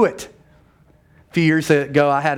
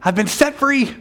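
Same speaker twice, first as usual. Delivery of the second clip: much higher, giddy with a laugh; mock elated churchgoer; 8 semitones higher than usual. Churchgoer leaving church, vowing never to sin, mock elated and much higher. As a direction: play the freed believer, overjoyed and a bit silly. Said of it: "you think".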